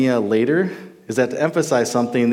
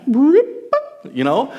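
high, amused "you know?"; 13 semitones higher, 3 dB louder than usual. Real, not acted. Preacher recalling a comedian's bit, amused high squeak. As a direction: squeak it out, tickled by the memory.

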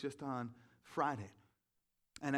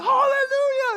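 much higher, much louder yell breaking into laughter; laughing hallelujah shout; 19 dB louder, slower than usual. Retold or imitated. Runner yelling praise at a passing woman, laughing shout. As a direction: belt it out, half laughing. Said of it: "and I was like".

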